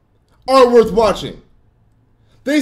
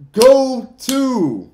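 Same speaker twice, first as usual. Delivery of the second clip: ordinary talk, nothing marked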